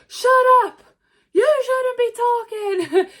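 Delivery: high, loud mock Rock shout; hammed-up high yell, mocking. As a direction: bark it like the Rock shutting him up. Rock yelling, retold.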